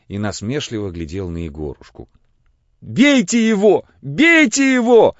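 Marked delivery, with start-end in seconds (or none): 2.8-5.2 put-on high, loud, drawn-out boy's cry; shouting. A narrator's reading. Feverish boy calling out in delirium, shouting. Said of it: "крикнул Егорушка".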